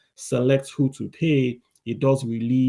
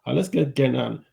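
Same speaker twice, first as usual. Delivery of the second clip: usual speaking voice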